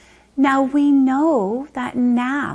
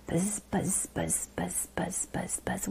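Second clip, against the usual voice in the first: whispered buzz buzz chant; buzzing bee; 5 semitones lower, 14 dB quieter than usual. Shown as a demonstration. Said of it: "Pretend you're a bee".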